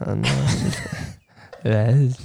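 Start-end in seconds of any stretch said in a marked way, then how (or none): none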